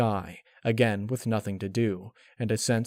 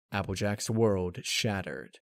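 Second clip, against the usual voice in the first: plain reading voice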